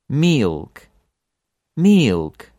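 "milk" with the vowel drawn out like meelk; slow, emphatic "milk"; lengthened Russian vowel. Shown as a demonstration. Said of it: "another example with milk".

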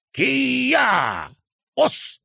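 sharp karate shout; martial arts cry. A narrator's reading. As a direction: cry out as you strike. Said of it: "вскричал Ораш".

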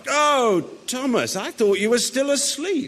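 high-pitched, delighted surprise; pleasant surprise. Acted. Prince finding Thomas already awake, pleasantly surprised. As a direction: greet him with a high, surprised lift.

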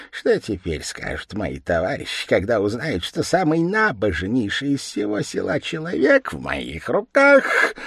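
gleeful demon scheming to himself; gloating devil. A narrator's reading. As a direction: relish the coming revenge. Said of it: "чёрт засмеялся от радости".

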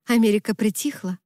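ordinary delivery, nothing marked

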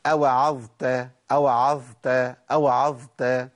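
أوعظت slowly, letting the ẓ flow into the t; audible ẓ before t. Shown as a demonstration. A teacher demonstrates the flowing ẓ in أوعظت. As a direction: keep the ẓ voiced in أوعظت. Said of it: "سامعينها؟".

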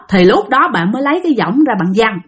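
usual speaking voice

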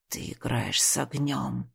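low, soft low looming threat hissed; through gritted teeth. Acted. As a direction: grind it out through clenched teeth. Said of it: "процедил он сквозь зубы".

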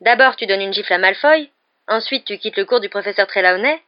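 loud, mildly incredulous listing; amazed exclamation. A narrator's reading. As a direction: count off the surprises, voice up. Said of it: "s'exclama Ron".

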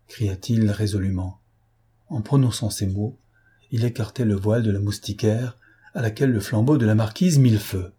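ordinary delivery, nothing marked

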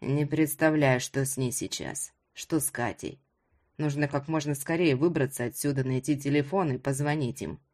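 low, inward hero's voice; subdued low-pitched inner monologue; Ruslan's worried thoughts. Acted.

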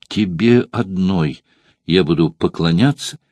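usual speaking voice

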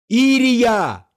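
high, stretched cry of a name; calling out over noise. A narrator's reading. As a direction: call to a wife across a crowded hall. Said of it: "Голос Тадеуша перекрыл шум зала".

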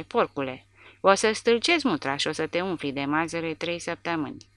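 ordinary delivery, nothing marked

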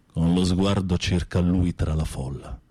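ordinary talk

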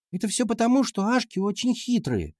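put-on high-pitched girl making excuses; schoolgirl class monitor. A narrator's reading. Class monitor explaining the rivals' wins to teacher, high girlish voice. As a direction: pipe up high, blaming the rivals.

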